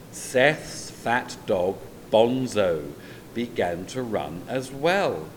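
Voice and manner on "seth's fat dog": plain reading voice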